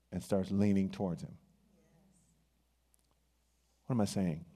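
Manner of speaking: usual speaking voice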